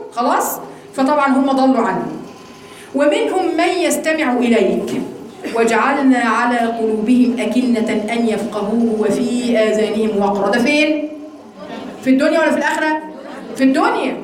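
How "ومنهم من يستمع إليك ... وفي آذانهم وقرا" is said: slow, drawn-out scripture recitation; verse recitation. Acted. Teacher reciting a verse before explaining it, slow recitation. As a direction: recite slowly, settling lower as the verse goes on.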